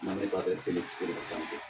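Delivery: low, soft Latin invocation; murmured blessing. Real, not acted. Healer blessing the patient, murmured prayer. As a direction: pray quietly under your breath.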